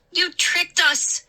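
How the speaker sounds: high, loud furious scream. A narrator's reading. Mindy screams at the two gnomes, high and loud. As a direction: scream the accusation. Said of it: "Mindy screamed".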